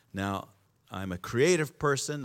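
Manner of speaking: normal talking voice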